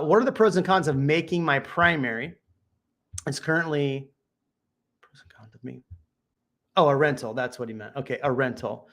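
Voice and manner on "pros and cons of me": whispered reading aloud; under the breath to self; whispering. Real, not acted.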